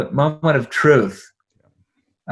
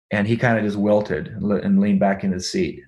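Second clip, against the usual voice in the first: ordinary talk, nothing marked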